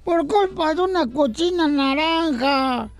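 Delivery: put-on high whiny voice of a sobbing little boy; crying boy. Host voicing a crying boy in a radio joke, high and whiny. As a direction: whimper it like a hurt kid.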